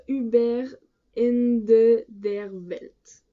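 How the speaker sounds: slow, loud German in a French accent; non-native German accent. Real, not acted. Vlogger unsure of German reads a title, careful French-accented German. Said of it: "je ne sais pas le dire en allemand".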